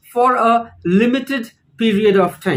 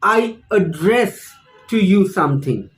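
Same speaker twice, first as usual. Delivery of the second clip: "address" stressed on its second syllable, a-DRESS; 3 semitones lower than usual. Shown as a demonstration. Teacher showing the verb in a sentence, second-syllable stress on "address". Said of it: "the stress is on the second syllable".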